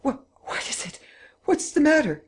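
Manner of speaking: whispered then high alarmed cry. A narrator's reading. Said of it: "gasped in dismay".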